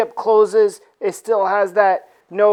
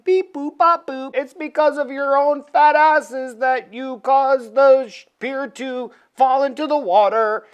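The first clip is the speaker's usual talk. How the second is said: mock robot ship; 4 semitones higher than usual. The ship scolds the reporters in a mock robot voice. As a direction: talk like a smug ship computer. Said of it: "The ship starts talking".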